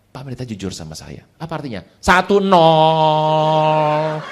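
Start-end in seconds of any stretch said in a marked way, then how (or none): 2.0-4.2 drawn-out score call. Retold or imitated. Pastor scoring himself in debate.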